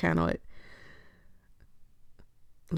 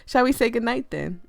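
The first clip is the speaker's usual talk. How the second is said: higher, louder, lively character voice; perky goodnight question, lifted and louder; corrected character's voice; 5 semitones higher, 5 dB louder than usual. Acted. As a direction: ask it brightly, pitched up. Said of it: "I had us mixed up".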